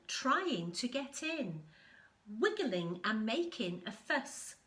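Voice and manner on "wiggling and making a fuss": ordinary delivery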